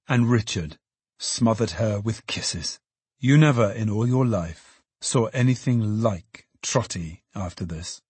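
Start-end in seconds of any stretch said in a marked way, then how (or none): none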